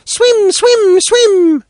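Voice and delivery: put-on high, loud, delighted little girl; joyful child's cry. Little girl sees the river, joyful high cry. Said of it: "joyfully clapped her hands, crying".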